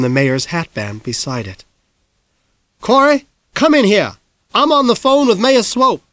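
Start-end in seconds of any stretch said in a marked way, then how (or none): 2.8-6.0 high, loud insistent call; mother yelling across the house while on the phone; mom calling out. Acted.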